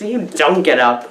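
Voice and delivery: ordinary talk, nothing marked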